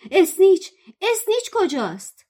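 high, loud roar. Acted. Charlie roaring across the row for the snitch, high loud roar. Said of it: "چارلی از آن سوی ردیف نعره زد".

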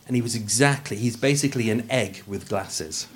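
usual speaking voice

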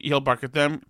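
ordinary delivery

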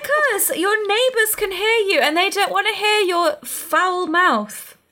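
high, playful reprimand through laughter; mock scolding. Playing the husband warning about neighbors, mock scolding. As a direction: scold primly, half laughing.

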